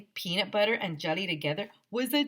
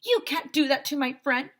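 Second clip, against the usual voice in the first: shout; 9 semitones higher, 3 dB louder than usual. A narrator's reading. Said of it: "Michael shouted".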